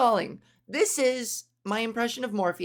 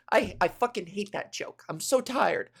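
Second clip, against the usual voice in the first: ordinary talk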